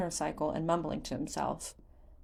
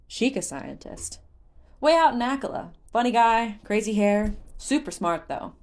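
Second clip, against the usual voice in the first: lively merchant recalled; 4 semitones higher, 6 dB louder than usual. Retold or imitated. Beetle remembered, quoted.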